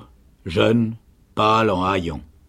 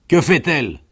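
loud, higher, pressing question; questioning sergeant; 6 semitones higher, 6 dB louder than usual. A narrator's reading. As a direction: press on at full voice. (question pressante et forte du sergent)

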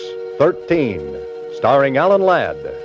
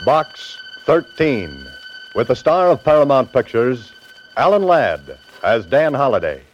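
booming announcer; 11 semitones lower than usual. Acted.

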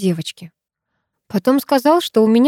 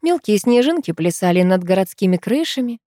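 ordinary delivery, nothing marked

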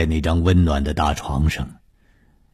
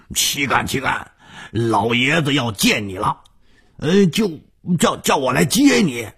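high-pitched character voice, deferential; lively high voice with small stumbles; polite visiting henchman; 10 semitones higher than usual. Acted. 武刚 come to fetch 洪金 for the old man, deferential.

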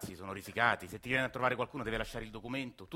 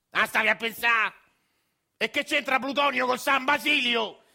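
much higher, much louder furious yell; angry shout; 12 semitones higher, 10 dB louder, slower than usual. Acted. Grandfather yelling at dreaming Agostino, loud furious shout. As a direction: bark him awake, fed up and furious. Angry old man scolding.

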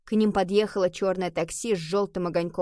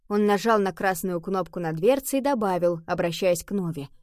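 usual speaking voice

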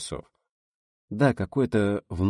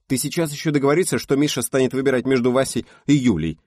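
sharp, raised voice of annoyance; louder, cross scolding; angry; 8 semitones higher, 8 dB louder than usual. A narrator's reading. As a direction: snap at a friend talking nonsense. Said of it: "Сердито высказалась она".

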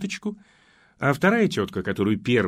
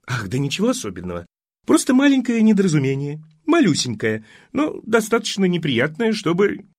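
put-on fluttery, higher fussing old mother; fussy old mother; 7 semitones higher, 5 dB louder than usual. Old mother waves off the accident, higher and fluttery. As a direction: flutter on, brushing it all off.

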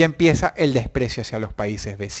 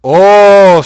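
loud oh; 4 semitones higher, 12 dB louder than usual. Real, not acted. Grito de inicio en directo.

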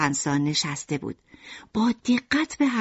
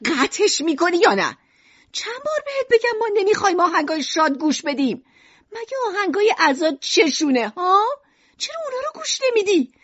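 much higher, shrill-toned scolding in a high voice; scolding mother; 11 semitones higher than usual. A narrator's reading. Mother scolds Alan over happy music, high angry voice. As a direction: scold the child hard.

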